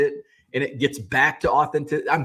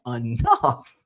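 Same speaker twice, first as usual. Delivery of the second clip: playful disbelief at his wife; teasing his wife, hammed-up surprise; mock astonishment; 3 semitones higher, slower than usual. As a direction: pitch shooting up on who are you. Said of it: "And I'm like".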